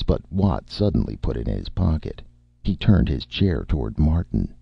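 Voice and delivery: usual speaking voice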